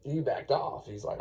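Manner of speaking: usual speaking voice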